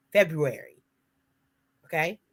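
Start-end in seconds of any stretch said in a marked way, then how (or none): 0.1-0.8 February said whole, with the R after the B; February as FEB-roo-air-ee, falling at the end; careful pronunciation. Shown as a demonstration. Cohost repeating the month for listeners, careful pronunciation. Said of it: "Make sure you pronounce all of the letters".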